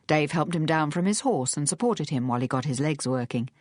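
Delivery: ordinary delivery, nothing marked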